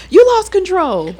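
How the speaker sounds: high-pitched accusing cry; exaggerated high scolding; mock scolding mother. Mother scolding her over spanking, retold.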